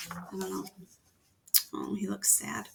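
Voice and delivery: soft sympathetic whisper. Real, not acted. Reader to the child about the picture, a soft whisper. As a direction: breathe it gently.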